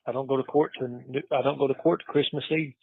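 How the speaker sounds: ordinary talk, nothing marked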